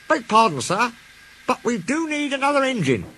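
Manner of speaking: a polite engine speaking up; Duck polite. A narrator's reading. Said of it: "Duck broke the awkward silence".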